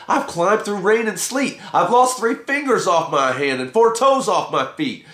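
Roy rages at the guru over his lost fingers and toes; angry rant. A narrator's reading. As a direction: vent every hardship at him. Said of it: "screamed Gimme Some Roy".

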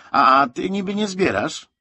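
high, loud pointed retort; half question, half statement. A narrator's reading. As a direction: throw it back as a half-question. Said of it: "Ni to spytał, ni to stwierdził".

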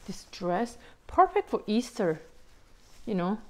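ordinary delivery

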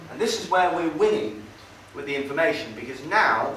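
ordinary delivery

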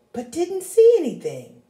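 usual speaking voice

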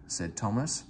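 ordinary delivery

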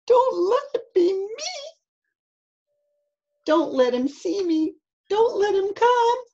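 high-pitched frightened begging; high, anxious storybook child voice; pleading child. Acted. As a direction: beg like a scared little boy in hiding. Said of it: "whispered Eddie".

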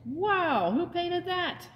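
amazed wow. Acted. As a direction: gasp out the wonder of it. Bob sees sunrise colors.

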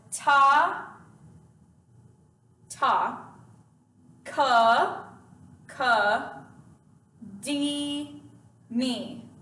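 ta, ka, di, mi drawn out one at a time; slow syllable-by-syllable. Shown as a demonstration. Teacher breaks takadimi down for students, very slow. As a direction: separate each syllable with a long pause. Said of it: "let's say it slowly".

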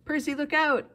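high, loud urgent cry; warning cry. A narrator's reading. Annabeth warns Percy as the Furies attack, crying out. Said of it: "Annabeth cried".

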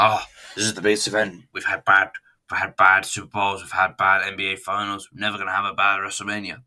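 loud, brisk mock commentary hype; hyped commentators. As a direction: imitate gushing ringside commentators, punching it out. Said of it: "they go back to the commentary room".

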